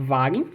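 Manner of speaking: Wagen with a V sound at the start; German Wagen. Shown as a demonstration. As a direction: say Wagen as vahgen. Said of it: "a palavra Wagen".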